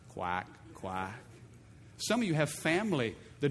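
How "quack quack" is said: low mocking quack, quack; duck quacks. Preacher voicing the devil as a duck, low mocking quack.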